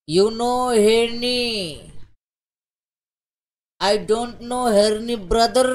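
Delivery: very slow, drawn-out boastful English; slow, deliberate mock-serious delivery; iron-eating strongman character. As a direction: draw out every word like a proud strongman.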